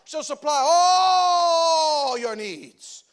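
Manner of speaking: slow, drawn-out, pleading mock prayer voice; mocking pious quote. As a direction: ham up a wailing believer claiming the verse. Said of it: "we quote Philippians four".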